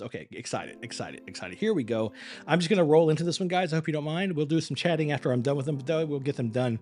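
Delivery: ordinary delivery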